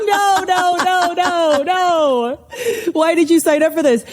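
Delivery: high, comic wail of refusal; mock protest. Mock refusal to student's logic.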